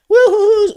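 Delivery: high, loud mock whistle; high whistly woo-hoo; imitated whistling. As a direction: mimic mom whistling away as if all is fine. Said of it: "whistling and pretending".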